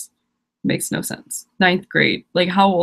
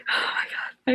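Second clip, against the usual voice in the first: exasperated whispered oh my God; 7 dB quieter, slower than usual. Real, not acted. Fed up with viewers' separate blogs, tired whisper. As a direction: sigh it out as a worn-out whisper.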